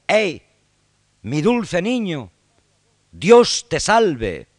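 high, loud, drawn-out calling to the king; crowd-piercing joyful yell, slow and high; shouted greeting from the crowd. Retold or imitated. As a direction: hail your old friend the new king at full voice. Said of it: "le grita desde la multitud".

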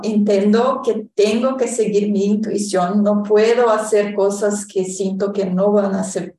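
ordinary talk

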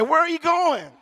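hammed-up mourner's question; mock funeral cry. Acted wail over passing body.

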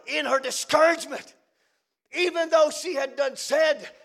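normal talking voice

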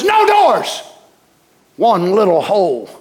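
ordinary talk

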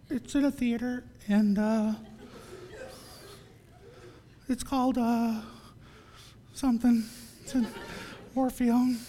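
soft, hesitant, halting mumble full of gaps; timid, fumbling. Acted. Inviting a waitress to church, timid and fumbling. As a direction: play a painfully awkward, shy inviter, very softly.

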